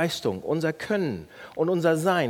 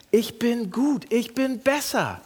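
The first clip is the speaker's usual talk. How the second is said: self-praising boast; 6 semitones higher than usual. Shown as a demonstration. Acting out boasting inner voice.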